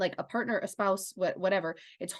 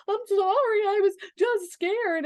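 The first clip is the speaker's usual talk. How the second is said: put-on high, loud child voice, slowed; little girl voice; 13 semitones higher, 6 dB louder than usual. Mother voicing her scared daughter after shots, high child voice. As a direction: voice the small scared daughter, apologetic. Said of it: "And she's like".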